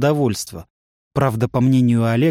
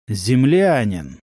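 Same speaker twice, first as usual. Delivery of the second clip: slow, cold and icy; much slower than usual. A narrator's reading. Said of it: "ледяным голосом произнёс Эфалир".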